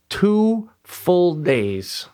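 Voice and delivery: very slow, each word drawn out; weighty, spaced-out emphasis; emphatic slow count. Real, not acted. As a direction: stretch every word to stress the ordeal. Stressing how long tree took.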